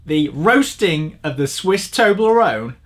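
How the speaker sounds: normal talking voice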